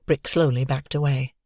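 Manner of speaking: ordinary delivery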